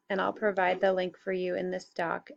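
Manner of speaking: ordinary delivery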